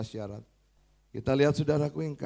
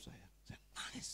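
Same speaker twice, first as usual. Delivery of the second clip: whisper; 18 dB quieter than usual. Real, not acted. Recalls first time crying.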